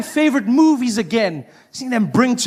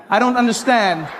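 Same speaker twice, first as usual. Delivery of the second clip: usual speaking voice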